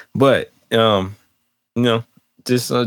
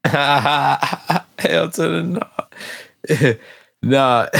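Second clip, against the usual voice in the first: real laughter spilling into words, higher pitch; laughing refusal; 6 semitones higher, much slower than usual. Real, not acted. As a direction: laugh hard while refusing, drawing it out. Laughing refusal after friend's teasing.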